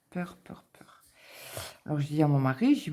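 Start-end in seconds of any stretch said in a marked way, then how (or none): 0.1-1.0 breathless frightened whisper; whispered fear. Real, not acted. Scared woman whispering her dread to viewers. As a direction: hush it out, scared stiff.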